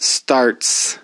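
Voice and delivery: Starts with a crisp ts at the end; example word. Shown as a demonstration. Teacher giving Starts as an English example, crisp ts. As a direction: stress the final ts of Starts. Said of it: "I wanna give you an example of that".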